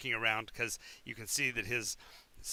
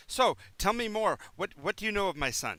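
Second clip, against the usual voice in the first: eager relief; 8 semitones higher, 5 dB louder than usual. Acted. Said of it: "shakes your hands all profusely and says".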